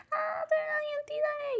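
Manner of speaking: high squeal held back to normal volume; imitating a squeal. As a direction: replay the fangirl shriek high but kept down. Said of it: "si me escuchaba chillando diciendo".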